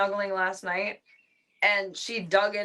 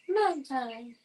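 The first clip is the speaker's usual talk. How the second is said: higher cat voice; voicing the cat; 5 semitones higher than usual. Shown as a demonstration.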